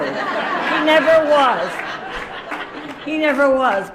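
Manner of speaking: never punched loud and high; stress on never. Real, not acted. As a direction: insist on the word never. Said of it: "that's not true".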